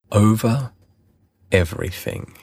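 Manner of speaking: simple, grand answer; majestic simplicity. A narrator's reading. King answers the prince, majestic and simple. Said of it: "with magnificent simplicity".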